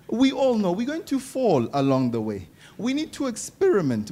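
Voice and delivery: usual speaking voice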